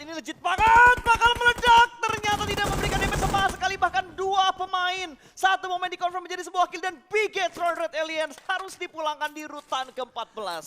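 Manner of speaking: very loud, high shouted esports call; full-force play-by-play. Real, not acted. Caster calling a grenade kill on Bigetron, shouted play-by-play.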